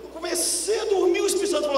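surge into the moment; dramatic build. Real, not acted. Telling how the Holy Spirit spoke mid-nap, in a fast, high rush.

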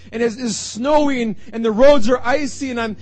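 loud, rising sermon build; impassioned preaching. Acted. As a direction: preach it with mounting fervour.

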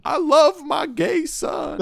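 cringy dad. Retold or imitated. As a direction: ham up the tacky eulogy. Imitating the dad's cringy eulogy.

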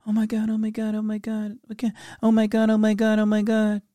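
mock panicking parent. Parent running to rescue a fallen child, mock panic. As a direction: play the overprotective parent in a tizzy.

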